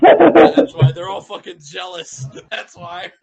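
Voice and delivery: words breaking into laughter; laughing while talking. Real, not acted. Player cracking up mid-line, laughing.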